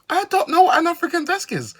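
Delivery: loud high-pitched exasperation; incredulous high shout; baffled outburst. Real, not acted. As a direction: blurt it out in high, loud disbelief. Baffled outburst over African desk.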